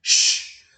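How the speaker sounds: loud hissing shh; loud shush. Shown as a demonstration. Teacher demonstrates the Portuguese hush sound. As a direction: shush the room loudly. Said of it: "o português diz assim".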